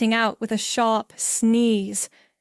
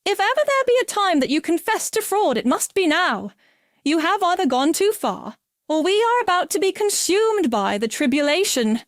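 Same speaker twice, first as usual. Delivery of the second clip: much higher, fast frightened plea; panicked; 8 semitones higher than usual. A narrator's reading. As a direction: beg in rising panic. Sarah panicked, demanding confession.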